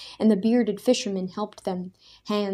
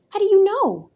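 much higher, loud challenge; demanding; 12 semitones higher, 9 dB louder than usual. A narrator's reading. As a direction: challenge the claim hard. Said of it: "Daniel demanded".

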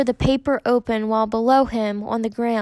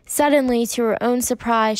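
plain reading voice

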